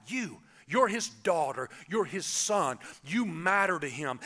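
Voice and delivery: ordinary talk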